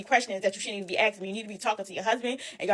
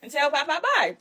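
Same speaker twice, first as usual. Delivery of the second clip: high sugary voice; sending the kids to say bye, high and sugary; forced polite; 6 semitones higher than usual. Retold or imitated. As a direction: fake sweetness while she's fuming. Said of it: "I said".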